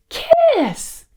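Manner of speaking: very high, loud squeal of a word; exclaimed climax. A narrator's reading. Fairies telling the prince how to wake her, high exclamation. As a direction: squeal the big reveal.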